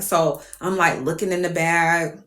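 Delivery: ordinary talk, nothing marked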